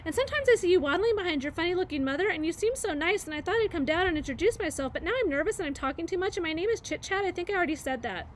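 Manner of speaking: high, fast, breathless babble; nervous chattering squirrel. Acted. Chit Chat admits she is nervous to Bright Bill, fast high babble. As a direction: tumble the words out nervously as the squirrel.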